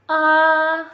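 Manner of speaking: "A" held long, high and loud; "A" in first tone, no rise or fall; high level tone. Shown as a demonstration. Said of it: "jadi tinggi ya".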